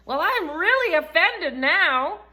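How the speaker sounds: high, loud, slow indignant imitation; mock offended lady. Mimicking the offended churchgoer's huffy reply. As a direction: ham up a huffy offended woman. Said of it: "the woman just looks at me and goes".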